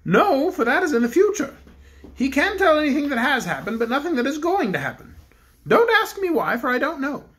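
the Bear King addresses the cook; kindly Bear King. Acted. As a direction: speak as a gentle storybook king. King speaks.

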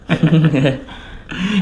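light laugh after teasing; amused chuckle. Real, not acted. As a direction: chuckle along at your own joke.

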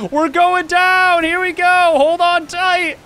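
high, loud and slow, stretched out; dramatic cry. Real, not acted. Boat tipping over the waterfall, dramatic cry. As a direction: draw it out like a thrill ride.

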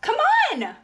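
high bright call out to a friend; excited shout of come on. A narrator's reading. Excited call in picture book.

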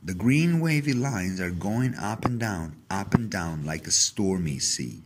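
plain reading voice

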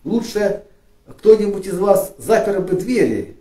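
ordinary talk, nothing marked

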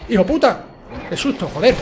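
jump-scared, swear it out at once; real fright. Real, not acted. Streamer jump-scared by an enemy in the game, loud startled cursing. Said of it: "qué susto me ha pegado el primero".

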